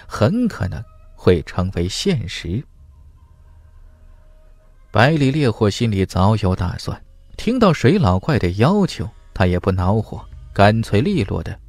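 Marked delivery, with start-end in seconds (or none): none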